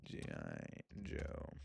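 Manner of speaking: very slow, hushed words; typing aloud. Real, not acted. Host searching for a film, slow and hushed. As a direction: murmur it while typing.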